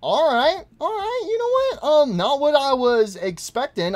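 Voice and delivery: real surprise; startled. Real, not acted. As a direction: flinch at the jump scare. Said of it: "jumpscare reaction".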